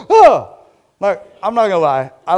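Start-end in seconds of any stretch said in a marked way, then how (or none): 0.1-0.5 sudden high, loud shriek of fright; jump-scare yelp, much higher and louder; mock terrified scream; startled scream. Acting out scared women at tomb.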